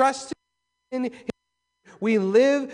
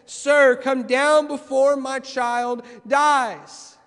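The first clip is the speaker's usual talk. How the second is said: slow, louder, pleading to Jesus; urgent plea; 6 semitones higher, 8 dB louder, much slower than usual. Retold or imitated. Official begging Jesus to save his dying child, urgent plea. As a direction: beg him, stretching each word out. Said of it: "The urgency is there".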